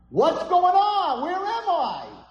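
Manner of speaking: very high, drawn-out loud yell; panicked boy. Acted. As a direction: cry out slowly in acted panic. Boy cries out before the judges.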